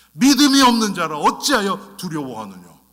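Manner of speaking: loud, ringing scolding voice; stern rebuke. Acted. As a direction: thunder the rebuke at the disciples. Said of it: "예수님이 그렇지 않는단 말이에요". (크고 엄하게 꾸짖기)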